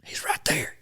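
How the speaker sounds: hushed whisper, barely voiced; retold whisper. Hunter whispering to his buddies about the duck. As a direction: whisper so the bird won't hear.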